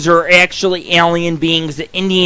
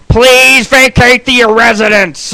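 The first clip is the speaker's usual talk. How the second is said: slow, higher official order; Coast Guard announcement; 4 semitones higher than usual. Retold or imitated. Imitating the Coast Guard at the fort, slow official announcement. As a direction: mimic an officer calling out a warning.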